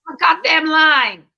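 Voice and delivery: loud, drawn-out hammy American voice; hammed-up American yell; mock American accent. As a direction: bellow it like a brash American showman. Mock American stage outburst.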